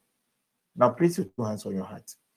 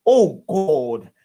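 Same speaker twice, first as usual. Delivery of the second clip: raised, emphatic prayer voice; fervent prayer leading; 5 semitones higher, 7 dB louder than usual. Real, not acted. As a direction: call the congregation to pray, forcefully. Leads congregation into prayer.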